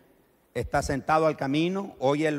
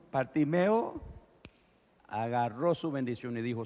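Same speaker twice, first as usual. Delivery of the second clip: usual speaking voice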